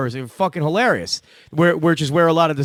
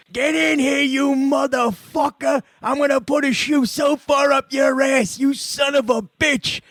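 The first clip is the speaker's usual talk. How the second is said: furious shout, much higher, slow; dad yelling; 10 semitones higher, slower than usual. Retold or imitated. Dad yelling at son over report cards, furious shout. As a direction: bellow at the kid with rage. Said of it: "he's like".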